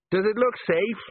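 high, anxious question; alarmed. A narrator's reading. Sergeant dangling from a roof asks about the pipe, alarmed. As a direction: pitch it up in alarm.

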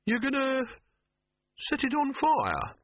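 high-pitched, drawn-out disbelief; appalled outburst. A narrator's reading. Silverfish reacts to Dibbler's burning-city script, appalled. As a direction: squeak it out slowly, aghast.

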